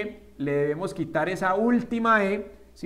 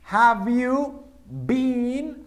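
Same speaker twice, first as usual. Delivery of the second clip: slow teacher dictation; very slow model; 5 semitones higher, 3 dB louder, much slower than usual. Shown as a demonstration. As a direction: Have you been, stretched with a higher rise. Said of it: "modeled question".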